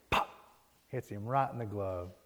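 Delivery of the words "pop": short, sharp imitated smack; popping noise. Ball lands in Smalls's glove, sharp pop. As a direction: percussive mouth pop.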